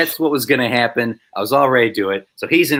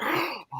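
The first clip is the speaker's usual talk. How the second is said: soft high gasp; mock shocked gasp; 10 dB quieter than usual. Extras reacting on the director's cue, hammed shocked gasp.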